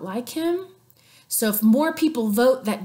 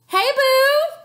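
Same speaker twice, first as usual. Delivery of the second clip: high, sing-song flirty greeting; high flirty hey boo; Cleopatra flirting; 12 semitones higher, 4 dB louder than usual. Retold or imitated. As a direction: coo it up high.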